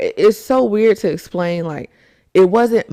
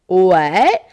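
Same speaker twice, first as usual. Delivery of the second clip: mock disbelief; 4 dB louder than usual. Imagined listener reacting in disbelief.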